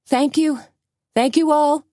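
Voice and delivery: very high showy shout; shouted thanks. A narrator's reading. Suzanne addressing the crowd like a showman, high shout. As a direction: play to the crowd. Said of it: "shouted Suzanne".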